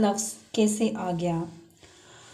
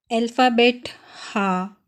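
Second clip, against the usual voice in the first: ordinary talk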